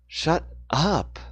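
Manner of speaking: furious. Acted. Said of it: "She was furious now".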